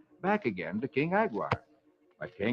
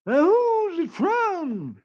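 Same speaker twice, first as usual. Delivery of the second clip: very high, very slow squeaky postman; high, slow cartoon postman; 5 dB louder, much slower than usual. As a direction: drag it out high and silly. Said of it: "And Brer Postman".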